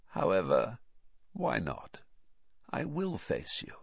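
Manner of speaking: soft, measured cold voice; cold, quiet. A narrator's reading. Master agrees to face the intruder, quietly. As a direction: agree softly and coldly. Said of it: "said the cold voice".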